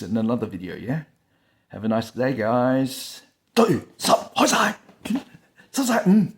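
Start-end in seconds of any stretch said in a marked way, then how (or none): 3.5-6.3 high, loud silly greeting voice; goofy sign-off. Acted. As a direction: squeak a playful goodbye.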